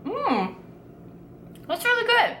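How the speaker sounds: high, pleased mmm; delighted. Real, not acted. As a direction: savor it with a high lift. Tasting apple cobbler.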